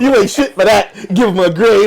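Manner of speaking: loud teasing through laughter; laughing. Real, not acted. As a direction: crack up while roasting. Teasing while laughing, louder.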